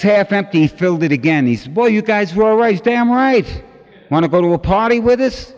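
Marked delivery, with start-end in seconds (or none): 1.7-5.5 put-on loud and high, delighted drunk. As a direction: play the drunk thrilled by free wine. Drunk man voiced, delighted.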